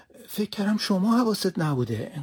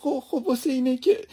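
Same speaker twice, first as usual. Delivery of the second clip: high, tearful protest of innocence, louder; tearful pleading; 6 semitones higher, 4 dB louder than usual. Acted. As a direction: cry out your defence to the master. Servant crying while explaining.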